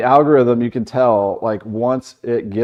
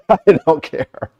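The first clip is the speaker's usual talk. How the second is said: higher, amused repeat; laughing dismissal; 5 semitones higher than usual. Real, not acted. Repeating his answer to the host about nasty comments, laughing. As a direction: laugh it off, unbothered.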